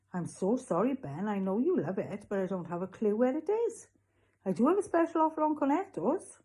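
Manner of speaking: lilting voice, climbing high into the pitch; apologise, then slide into a sales pitch; apologetic shopkeeper turning salesman. Acted. Voices Raj the newsagent.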